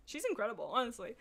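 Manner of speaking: ordinary delivery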